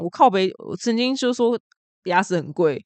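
ordinary talk

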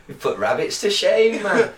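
chuckling through the words; laughing. Real, not acted. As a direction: laugh into the line. Laughing at the friend's joke.

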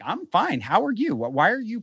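high-pitched, stammering mock bafflement; bewildered. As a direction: replay the exchange in a high, thrown voice. Replaying the baffling exchange, bewildered.

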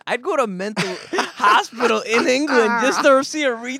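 high, loud mock English accent. Joking about England, mock English accent, high and loud. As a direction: put on a silly English accent. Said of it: "a Down syndrome Englishman".

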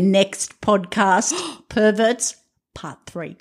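slow, drawn-out mock-dramatic announcement; string it out to build anticipation; dramatic gasp before the reveal; building suspense. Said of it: "I'm building sizzle".

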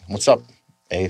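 "What's up, eh?" with a rising Canadian "eh" tag. Retold or imitated. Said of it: "But we're like".

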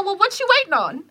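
high-pitched, taunting comeback; retold challenge. Shopper daring a hostile woman in line, goading. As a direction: throw the question out high, daring her.

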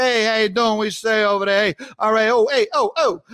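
a hint of a New York greeting; New York phrases. Host mimicking his own New York greetings, light accent. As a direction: toss off casual New York hellos. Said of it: "I'm always like".

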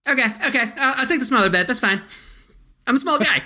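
high eager little-cousin voice; Leo Getz impression. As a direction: pitch up like an overexcited kid.

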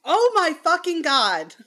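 high, loud shocked yell; startled outburst. Real, not acted. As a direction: shout in alarm. Cat knocks things over.

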